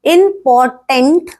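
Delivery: इंपॉर्टेंट broken as "im - por - tant"; slow, separated chunks of इंपॉर्टेंट; split into syllables. Shown as a demonstration. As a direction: sound out इंपॉर्टेंट piece by piece. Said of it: "syllables ऐसे होता है".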